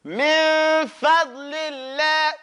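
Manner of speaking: "Min fadlillah" very slow, nasal n held long; drawn-out dad. Shown as a demonstration. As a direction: stretch each syllable of "Min fadlillah". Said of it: "Don't say min fadl".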